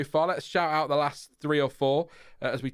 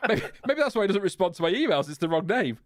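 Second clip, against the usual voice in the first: laughing; 7 semitones higher than usual. Real, not acted. Laughs at misnaming Albon as Chris.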